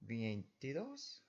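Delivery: veintidos drawn out slowly with a rising question; wrong stress, slowly. Shown as a demonstration. Teacher slowly mocking the unaccented reading, vein-TI-dos?. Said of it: "A bit weird, right?".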